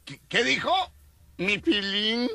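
high astonished murmur; stunned crowd. Retold or imitated. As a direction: ask it in disbelief. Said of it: "Así como".

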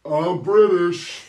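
loud, high, drawn-out British caricature; mock British accent. Prankster claiming to be British on the phone, slow shouted mock British. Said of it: "Why do you sound like that?".

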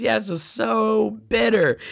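mock disgust. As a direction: imitate someone repelled by bitterness. Student complains about salt water.

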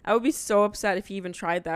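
usual speaking voice

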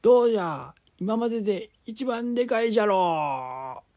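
put-on high, loud old man. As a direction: boast like an excited old grandpa. Grandpa boasting.

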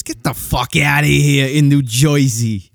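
hammed-up old-school Jersey accent, "Joy-zee"; mock New Jersey accent. Host scoffing at Turnpike exits, mock New Jersey accent.

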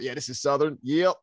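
retold hillbilly take on Southern American; hillbilly Southern accent. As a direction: overdo a Southern hillbilly twang. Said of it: "hillbilly type thing".

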